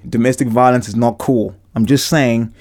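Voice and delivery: normal talking voice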